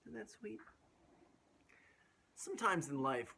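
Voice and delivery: ordinary delivery, nothing marked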